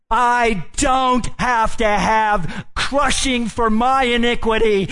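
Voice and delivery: high, loud, slow pulpit declaration; preaching at full force. Acted. Preacher thundering to the congregation about substitution, loud and slow. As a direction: proclaim it to the back row.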